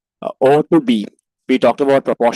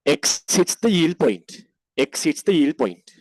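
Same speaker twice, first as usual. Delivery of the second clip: dictating; 2 semitones lower than usual. Shown as a demonstration. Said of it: "write like this".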